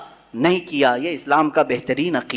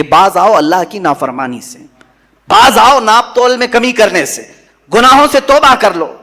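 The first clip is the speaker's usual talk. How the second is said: higher, louder prophetic warning; a prophet's raised warning to his people; stern warning; 5 semitones higher, 6 dB louder than usual. Acted. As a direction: call them to repent with force.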